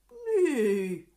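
loud drawn-out animal noise, falling away; full-might animal call. A narrator's reading. Goose calling with all her might, loud imitated animal noise. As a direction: belt out a farm animal call. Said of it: "with all her might".

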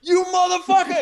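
high, loud, raging scream; furious yell. Retold or imitated. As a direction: lunge at him with the curse. Enraged guy attacks with scraper.

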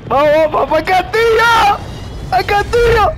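high, stretched-out battle call; rally your teammate with high yells; excited rallying shout. Real, not acted. Rallies friend toward the castle. (arenga a gritos agudos)

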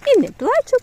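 put-on high, loud little-boy voice; mock child's boast; voicing the toddler. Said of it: "каже я".

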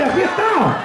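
a full-voiced "Aquí está" at the top of the build; shouted praise. Shown as a demonstration. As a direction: cry "Aquí está" out to the whole church. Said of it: "dígale, levante la mano y".

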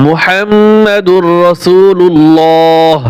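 মুহাম্মাদুর রাসূলুল্লাহ stretched slowly with doubled m; slow recitation. Shown as a demonstration. Teacher modelling the kalima for learners. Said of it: "র জবর".

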